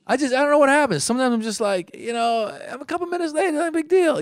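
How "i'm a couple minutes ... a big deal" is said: high-pitched, airy brush-off; breezy dismissal. Acted. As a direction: wave it away in a high voice. Shrugging off being late.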